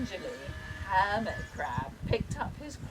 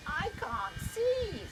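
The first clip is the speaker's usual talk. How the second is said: worried snail; 13 semitones higher, slower than usual. A narrator's reading. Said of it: "said the snail".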